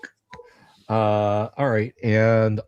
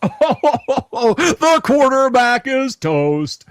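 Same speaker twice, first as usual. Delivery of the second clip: laughing proclamation; 11 semitones higher, 11 dB louder, much slower than usual. Real, not acted.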